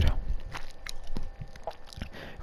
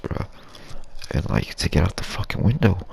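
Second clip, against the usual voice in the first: usual speaking voice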